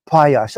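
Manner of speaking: "Pious" pronounced PIE-us; first syllable of "Pious" like the word pie; corrected pronunciation. Shown as a demonstration. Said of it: "How do you say it?".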